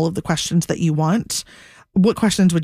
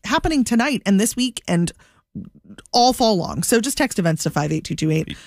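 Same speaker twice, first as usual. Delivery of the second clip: ordinary talk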